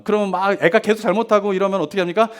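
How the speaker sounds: normal talking voice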